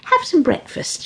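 a hint of granny coaxing; lightly voiced grandmother offering food; suggesting granny. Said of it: "she suggested".

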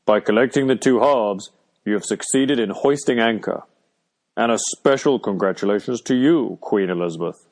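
mildly boisterous announcement in character; hearty, ceremonious congratulations; a touch of pomp and cheer. A narrator's reading. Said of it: "he said boisterously".

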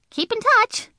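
put-on high, chirpy little girl send-off; breezy goodbye. Junie B waving off the teacher, high and chirpy. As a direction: wave him off, high and light.

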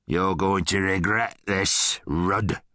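slow, gruff menace; threatening guard. A narrator's reading. Guard backs off from Rudd, slow gruff threat. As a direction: warn him slowly, like a bully.

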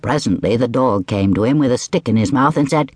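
ordinary delivery

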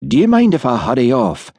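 a touch of worry, a little hurried; anxious. A narrator's reading. Dick asking Hamish to leave, mildly anxious. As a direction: ask to leave with mild unease. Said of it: "said Dick anxiously".

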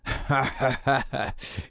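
guard laughs off the prisoner's demand; guard's mocking laugh. A narrator's reading. Guard mocking the prisoner with a laugh. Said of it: "You make me laugh".

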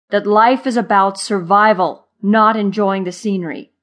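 loud, emphatic correction; exasperated lecture. A narrator's reading. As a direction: drive the lesson home loudly. Zimmer lectures carefree Marva.

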